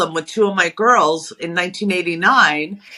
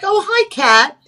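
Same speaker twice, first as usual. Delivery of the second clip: bright, high, happy recognition; surprised greeting; 10 semitones higher, slower than usual. Retold or imitated. Sees who she thinks is Kat walking in, pleased surprise. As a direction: greet a friend you spot by surprise. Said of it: "I was like".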